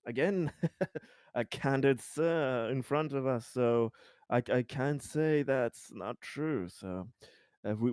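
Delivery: ordinary talk, nothing marked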